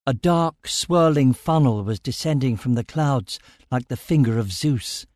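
ordinary delivery, nothing marked

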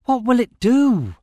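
high, loud, urgent question; shouting over wind. A narrator's reading. Narrator asks Amira about the funnel, shouting. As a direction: yell the question into the gale. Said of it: "I shouted over the rising wind".

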